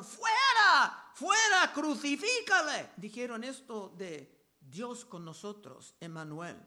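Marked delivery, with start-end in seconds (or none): 0.0-2.9 high, loud mob cry building then easing; preacher voicing a furious mob, yelling; crowd shouting. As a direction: shout it like an angry crowd demanding death. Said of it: "Pero ellos gritaron".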